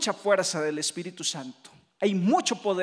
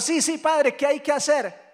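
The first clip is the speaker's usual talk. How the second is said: loud, higher-pitched mock eagerness; eager volunteer; 6 semitones higher, 6 dB louder than usual. Church volunteer offering the priest help, mock-eager. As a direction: act out a keen helper jumping to offer.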